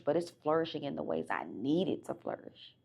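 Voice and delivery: ordinary talk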